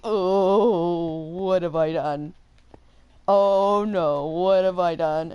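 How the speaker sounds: drawn-out, regretful "oh no"; mock dread. Her reacting to kids calling her a gamer, mock dread. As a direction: groan it with comic regret.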